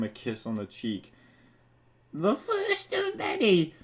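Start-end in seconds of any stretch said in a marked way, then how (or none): 2.1-3.7 slow high flirty Peach; high princess voice. Acted. Peach kissing Mario's cheek, in a high slow coo.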